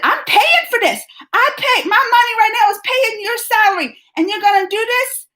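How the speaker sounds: mother confronting the private school, indignant; retold furious outburst. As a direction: fire it back at the school, outraged. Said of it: "Oh, I was mad".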